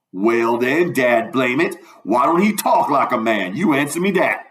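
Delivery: lightly voiced exasperated dialect character; mildly exasperated Southern debater; Jim, triumphant challenge. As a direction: throw the question back in Southern dialect. Voices Jim winning the argument.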